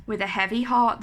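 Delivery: plain reading voice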